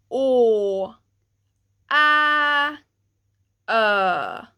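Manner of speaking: loud, clear "o, a, ö", each set apart; Swedish letters demo. Shown as a demonstration. Teacher introducing å, ä, ö sounds to learners. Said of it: "let's try one time together".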